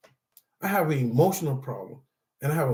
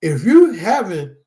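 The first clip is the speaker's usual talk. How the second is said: louder preacher's call; preaching; 5 dB louder than usual. Real, not acted. Speaker to support group, preaching the verse. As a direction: drive the scripture home like a sermon. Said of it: "I don't know why I'm preaching like this".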